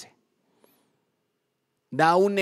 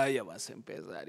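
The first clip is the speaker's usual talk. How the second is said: low, softer mock-exasperated mother; lightly voiced weary mom; 7 semitones lower, 9 dB quieter than usual. Mom to her child who keeps apologizing, mildly exasperated. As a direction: sigh it out as a fed-up mom. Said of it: "la mamá hasta se queda".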